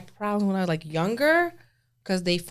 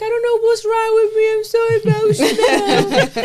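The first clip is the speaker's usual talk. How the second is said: high, loud half-laughing wail of confusion; mock distressed wail; 14 semitones higher, 8 dB louder than usual. Mother laughing at her own mood swings, high mock wail.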